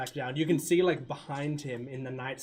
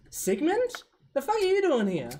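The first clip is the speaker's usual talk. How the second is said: much higher and louder, startled outburst; shocked Sasha; 9 dB louder than usual. Acted. Sasha spots Sigmund, shrill startled much higher cry. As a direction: yelp it out, caught off guard. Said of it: "and she goes".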